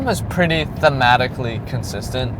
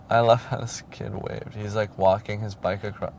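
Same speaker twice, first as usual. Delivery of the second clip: hushed aside; 3 semitones lower, 9 dB quieter than usual. Real, not acted. Aside about a kid outside.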